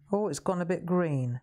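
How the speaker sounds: usual speaking voice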